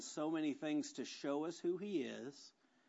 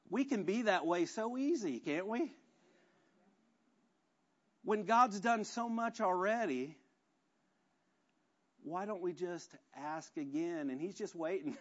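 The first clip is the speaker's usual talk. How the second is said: ordinary talk, nothing marked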